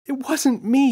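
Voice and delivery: put-on a boy shouting his innocence; yelling. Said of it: "yelled James".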